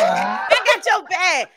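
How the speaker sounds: slow, high mock shout; drawn-out shout. Real, not acted. Echoing the cheer.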